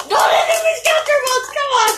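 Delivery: high yelled plea; urgent shout. Real, not acted. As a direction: yell it at the arena. Urging his top on.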